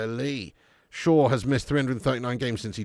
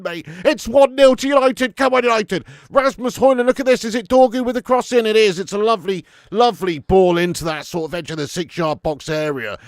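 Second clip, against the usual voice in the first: normal talking voice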